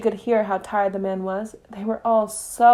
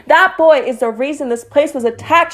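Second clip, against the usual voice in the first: shouting; 6 semitones higher, 8 dB louder than usual. A narrator's reading. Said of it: "she shouted".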